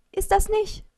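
high, loud, urgent cry; alarmed shout. A narrator's reading. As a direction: shout to stop someone right now. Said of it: "rief ich". (alarmierter, lauter Aufschrei)

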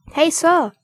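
loud, high call after someone. A narrator's reading. Pool dwarf calling after running Mumm, loud. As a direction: call him back sharply. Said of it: "rief ein Personalzwerg".

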